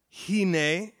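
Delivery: "Hine" said hee-NAY, Hebrew style; Hebrew word hine. Shown as a demonstration. Preacher teaching the Hebrew word, Hebrew pronunciation. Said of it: "The Hebrew is hine".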